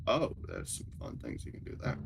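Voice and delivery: deep robotic voice mod; disguised deep voice. Real, not acted. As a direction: voice changer pitched very low. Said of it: "voice changer with voice mod".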